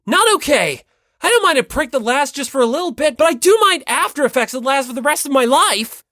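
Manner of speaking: high, loud, fast refusal; high frantic shout, rushing the middle; panicked protest. Acted. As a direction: yell it in a panic. Hinata protests the injection.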